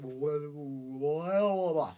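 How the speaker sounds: very slow, drawn-out droning blah; distant voices. Retold or imitated. Passing faces and voices during blackout, slow droning. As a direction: let the voices smear past.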